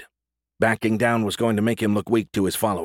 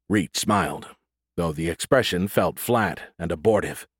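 usual speaking voice

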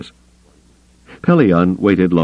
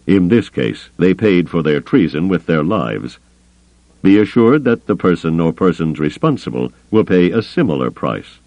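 plain reading voice

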